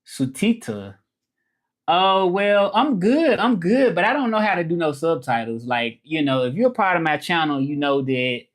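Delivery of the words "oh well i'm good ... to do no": excited lift. Real, not acted. Reacting to chat.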